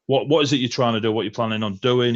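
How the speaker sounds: ordinary delivery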